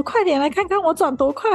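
high, playful spirit voice, well above her own; the plate spirit showing off its spin, high and gleeful; spinning Ouija plate spirit. Retold or imitated. Spirit in the joke boasting to the teacher, high gleeful voice. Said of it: "他就说".

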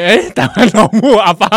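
laughing mock farewell.

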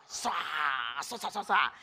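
long swish, then quick scrapes; imitated scrubbing. Child scrubbing dad's car with steel brush, imitated scraping. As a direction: mimic a brush scraping back and forth.

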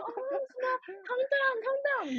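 high, very slow calm down in English; foreign coach soothing. Retold or imitated. Foreign coach soothing the crying boy on the bridge, slow. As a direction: slowly soothe a scared child. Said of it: "外国人来跟他说".

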